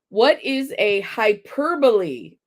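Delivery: hyperbole as "hy-PER-buh-lee", slowly; correct HYPERBOLE. Real, not acted. Host rereading the question, hyperbole as "hy-PER-buh-lee". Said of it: "Once I said the question right".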